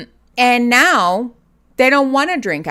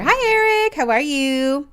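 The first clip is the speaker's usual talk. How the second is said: pet greeting voice; 7 semitones higher, 3 dB louder than usual. Real, not acted. Streamer greeting her cat on stream, high baby talk. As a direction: greet the cat sweetly, pitched up.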